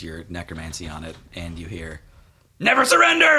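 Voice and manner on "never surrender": high, loud heroic yell; defiant battle cry. Acted. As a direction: shout it like a dying hero refusing to quit. GM voices defiant fleeing boar.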